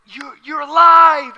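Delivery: high, loud, drawn-out cry of amazement; stammer, then shout in joyful disbelief; acted ecstatic outburst; overjoyed shout. Women grab risen Jesus, overjoyed.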